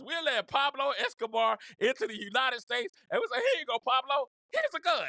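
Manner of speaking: high, loud, hammed-up hospitality; mock welcome. Mock official welcoming Pablo Escobar, hammed-up welcome. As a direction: announce it like a generous host, rising in pitch.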